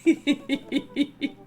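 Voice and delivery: speaking through real laughter, pitch jumping up; giggly and breathless, words bursting out high; laughing. Real, not acted. Player cracking up at the Dadmazon joke, laughing loud.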